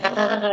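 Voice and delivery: high-pitched creature scream, retold. Creature fleeing the giant, shrieking high. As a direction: squeal like a creature running from a giant. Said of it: "and I'm screaming".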